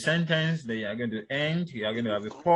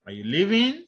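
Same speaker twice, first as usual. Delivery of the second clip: LEAVING swept up high and a bit louder; rising question intonation; 8 semitones higher, 4 dB louder than usual. Shown as a demonstration. Lecturer showing a yes or no question, rising intonation. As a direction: climb steeply through "leaving". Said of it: "What of rising?".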